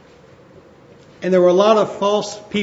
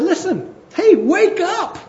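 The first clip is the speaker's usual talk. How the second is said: higher, louder exasperated plea; urgent wake-up call; 9 semitones higher, 3 dB louder than usual. Retold or imitated. Paul rousing the people, urgent exasperated call. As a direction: shake them awake, rising to a cry. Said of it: "And Paul said".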